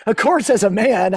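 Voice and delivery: ordinary delivery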